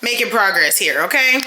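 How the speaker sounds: ordinary talk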